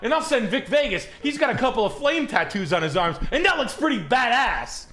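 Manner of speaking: high, hammed-up tough-guy threat; a joking high-pitched warning to a chatter; mock tough guy. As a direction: puff up like a wannabe enforcer.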